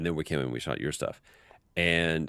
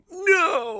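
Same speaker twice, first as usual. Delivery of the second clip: a far higher, louder wail of despair; mock anguished cry; 4 dB louder than usual. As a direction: howl it like part of you just died. Dismay at the scene being cut.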